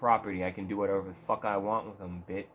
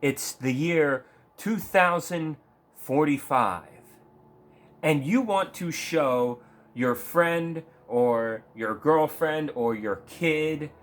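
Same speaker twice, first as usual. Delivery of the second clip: mock dramatic reading; 4 semitones higher, 3 dB louder, slower than usual. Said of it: "This is what they said".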